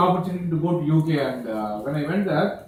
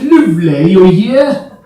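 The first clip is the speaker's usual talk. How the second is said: loud, sing-song mock Cockney; Cockney accent; 8 dB louder, slower than usual. Cockneys welcome you here, in loud Cockney accent. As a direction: exclaim it in exaggerated Cockney, "luv-leh". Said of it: "they say".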